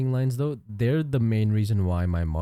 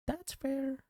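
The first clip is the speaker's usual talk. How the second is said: much higher, soft squeak; squeaky high voice; 11 dB quieter than usual. Pretend. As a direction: squeak it out playfully. Repeats phrase in squeaky voice.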